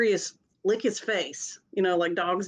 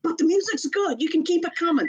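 high, lightly mocking voice telling the dudes it's fine; mock chill voice; 8 semitones higher than usual. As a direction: say it like a laid-back higher-pitched hippie. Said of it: "And I was like".

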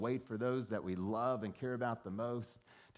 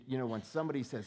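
ordinary delivery

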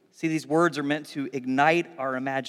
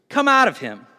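loud, raised-pitch order; stern command; 6 semitones higher, 8 dB louder than usual. A narrator's reading. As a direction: drive it out with a forceful command. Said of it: "Jesus said sternly".

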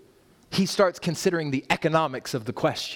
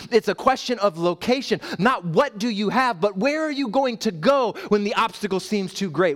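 rising, louder preaching with urgent repeated questions; full-force preaching; 7 semitones higher, 4 dB louder than usual. Acted. Pastor pressing the church on where they turn, in full preaching cry. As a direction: drive each "where" home to the congregation.

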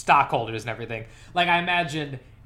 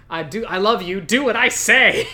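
mocking quote; 8 semitones higher, 8 dB louder than usual. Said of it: "a weird line where it's the".

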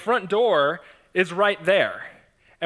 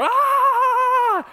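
high, loud mock scream of terror; a sudden squealed scream; imitated girls' scream; 5 dB louder than usual. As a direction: shriek like a terrified teenager. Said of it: "screaming at the top of their lungs".

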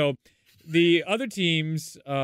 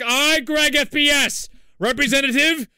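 pompous herald's shout, high and loud; Greg FPS; 10 semitones higher, 8 dB louder than usual. Retold or imitated. Teammate mimicking Greg announcing himself on the call, mock-grand proclamation. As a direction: proclaim it like a grand royal envoy. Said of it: "you just hear Greg".

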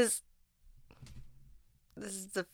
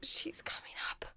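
urgent hushed whisper; 13 dB quieter than usual. Real, not acted. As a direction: breathe it without voice. Warning that someone is approaching.

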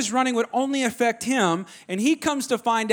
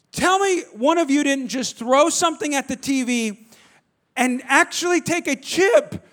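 acted rising outrage, higher and louder; exasperated parent grilling the kids; angry interrogation; 5 semitones higher, 4 dB louder than usual. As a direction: build from accusing to a loud demand. Retold angry questioning of his kids.

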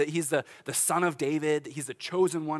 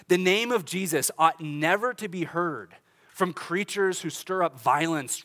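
ordinary delivery

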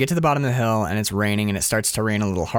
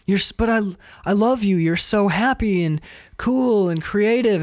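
Jim Carrey as Joel; 8 semitones higher than usual. Retold or imitated. Joel pleading with crying Clementine in the car, higher voice. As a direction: reassure her tenderly, voice pitched up. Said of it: "he goes".

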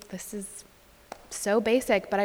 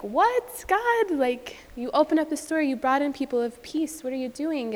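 much higher, disbelieving plea; bewildered cry to God; 6 semitones higher than usual. Retold or imitated. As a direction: ask God what he's doing, stunned. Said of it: "we were like".

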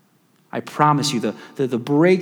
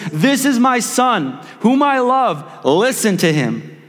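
mock booming voice; 8 semitones higher, 3 dB louder, slower than usual. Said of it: "this cloud with a booming voice".

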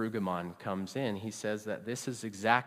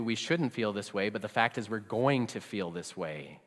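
usual speaking voice

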